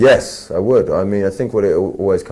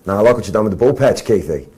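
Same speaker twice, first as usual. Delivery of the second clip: put-on fast, louder Australian accent quote; 4 semitones higher, 4 dB louder, faster than usual. Asked for an Australian accent, quoting Chopper in Australian. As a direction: rattle off a Chopper line in broad Australian. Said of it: "Can you do a good Australian accent?".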